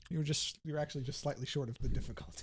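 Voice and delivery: hushed, unvoiced aside; whisper. Real, not acted. Game master telling a failed roll, whispered. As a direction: breathe the bad news softly.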